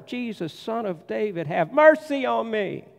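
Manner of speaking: high cry for mercy; pleading cry. Retold or imitated. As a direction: call out again over the crowd. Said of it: "he shouts even louder".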